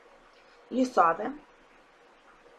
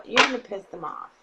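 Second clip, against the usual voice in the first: ordinary talk, nothing marked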